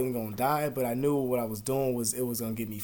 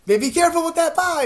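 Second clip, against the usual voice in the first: much higher, louder put-on voice; mocking coworker voice; 11 dB louder than usual. Mimicking a coworker at the job, loud high mock voice. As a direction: ham up the fussy coworker.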